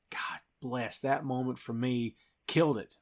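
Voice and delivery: hushed reverent whisper; real whispered God bless; whispered awe. Real, not acted. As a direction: breathe it out, moved. Awed aside about Thor's look.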